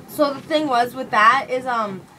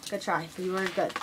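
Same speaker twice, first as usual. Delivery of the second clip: "Good try" repeated much softer, gently; softly consoling; 5 semitones lower, 11 dB quieter than usual. Real, not acted. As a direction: say "Good try" quietly, as comfort.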